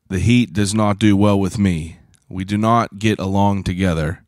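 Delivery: ordinary delivery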